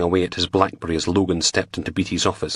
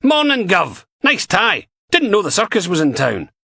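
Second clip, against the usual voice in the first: higher, louder, sardonic Scottish DI; dry mocking jab; lightly voiced Steele; 4 dB louder than usual. A narrator's reading. Steele mocking Finney's tie.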